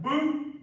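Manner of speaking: loud, high mock booing; mock boo at own call. Shown as a demonstration. Ref booing his own bad call, loud and high. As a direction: boo yourself like a heckler. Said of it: "it's like".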